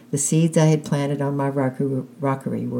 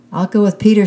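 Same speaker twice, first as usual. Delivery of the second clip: high, loud eager yell; 7 semitones higher, 9 dB louder, faster than usual. A narrator's reading. Little boy claiming his partner, loud yell. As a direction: shout to claim Peter first. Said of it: "yelled Johnny".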